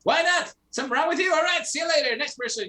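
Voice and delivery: high, brash carnival barker. Retold or imitated. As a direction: hustle passersby like a fairground barker. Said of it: "Like, carnival barking?".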